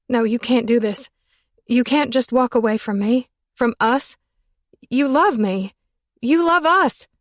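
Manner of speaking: high, loud desperate plea; floundering plea. Acted. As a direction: reach for him with the voice, climbing. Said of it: "I was floundering".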